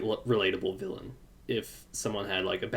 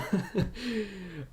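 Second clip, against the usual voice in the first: high giggle; 8 semitones higher, 6 dB quieter than usual. Real, not acted. Laughing at Ruby scene.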